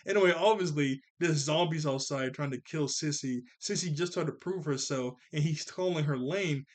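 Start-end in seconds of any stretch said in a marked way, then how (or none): none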